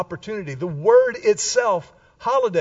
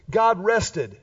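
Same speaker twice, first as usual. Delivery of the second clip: normal talking voice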